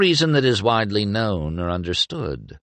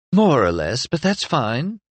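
Mira voice; 7 semitones higher than usual. A narrator's reading.

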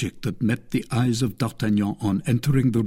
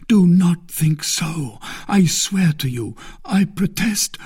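raised, insistent pleading; earnest protest; 8 semitones higher, 4 dB louder than usual. A narrator's reading. D'Artagnan to the dying man accusing him, earnest protest. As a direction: protest innocence with rising urgency.

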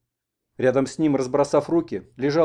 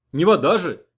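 raised, indignant reminder; shouted scolding; 5 semitones higher, 6 dB louder than usual. A narrator's reading. Grandpa protests the lavish use of horilka, scolding shout. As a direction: object sharply to the waste. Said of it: "прикрикнул он".

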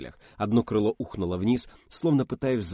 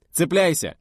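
loud high command to grab on; urgent order; 8 semitones higher, 6 dB louder than usual. Acted. Young orders the pilot to hold on, shouting. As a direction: bark it over the noise.

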